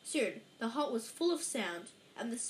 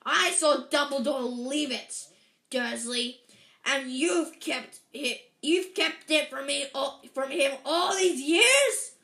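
trembling with rage; 3 semitones higher, 10 dB louder than usual. A narrator's reading. Giant accusing the uncle of secrecy, raging. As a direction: weigh each word angrily, louder to the end. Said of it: "every syllable trembled with rage".

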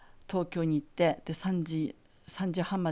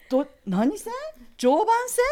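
much higher and louder, baffled question; puzzled teacher; 6 dB louder than usual. Retold or imitated. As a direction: imitate the teacher's startled confusion. Teacher puzzled by train line.

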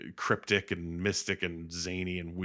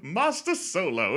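loud, high, drawn-out fussy droid; C-3PO; 10 semitones higher, 5 dB louder, slower than usual. Retold or imitated. Protocol droid busting in on the kiss, loud interruption. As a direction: call out slowly like a prim robot butler. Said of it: "three P O's like".

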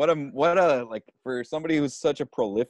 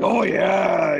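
loud, higher Pacino shout; Pacino impression; 8 semitones higher, 7 dB louder than usual. Guest recalling Pacino at checkout, a loud shout. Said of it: "He's like".

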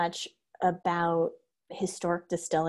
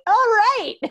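amused surprise; 9 dB louder than usual. Retold or imitated. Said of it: "And I was like".

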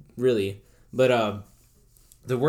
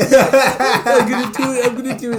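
imitating the friend, laughing; giggly mock threat; 9 semitones higher, 7 dB louder than usual. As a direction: cackle out the dare. Friend's excited quote, laughing.

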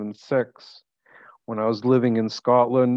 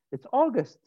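mother's protest; 12 semitones higher than usual. Acted. Acting out mother repacking his suitcase.

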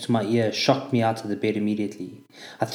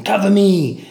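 loud, high battle shout; urgent call for cover; 9 semitones higher, 7 dB louder, slower than usual. Retold or imitated. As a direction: yell it to your buddy under fire.

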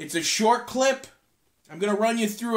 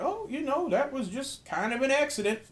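Vince Gilligan impression; 6 semitones higher, 5 dB quieter than usual. Host imitating a TV creator answering fans, weird Southern accent. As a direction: imitate a mild-mannered Southern accent, pitched up and light. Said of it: "weird Southern whatever accent".

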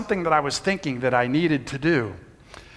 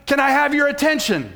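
high, raised announcer-like call easing off toward the end; top-of-lungs yell; 6 semitones higher than usual. Retold or imitated. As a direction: call a whole room to attention. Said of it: "I yelled out, top of my lungs".

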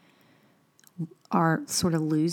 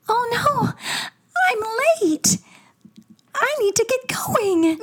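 very high, breathless princess voice; sing-song Disney princess in a rush; cartoon princess. Shown as a demonstration. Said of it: "a Disney princess voice".

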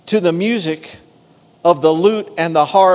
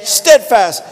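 slow, higher, shouted proclamation; preaching at full force; 4 semitones higher, slower than usual. Real, not acted. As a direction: build the praise line by line like a sermon peak. Preaching the psalm.

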